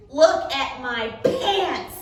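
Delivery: grumbling at his muddy pants; grumpy complaint. Acted. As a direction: drag it out, put out and cross. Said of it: "which was his bad mood noise".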